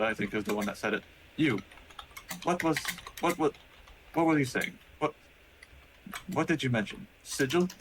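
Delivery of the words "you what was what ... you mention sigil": higher, halting, urgent questions; startled robot voice. Acted. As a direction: stammer it out, a machine jolted awake. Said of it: "And then he looks to Tusa".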